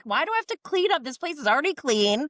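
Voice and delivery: high, fast, indignant mock question; mimicking a fussy wife. Friend retelling Wife Swap, voicing the other wife high and indignant. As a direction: squeal the complaint like the neat-freak wife. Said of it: "She's like".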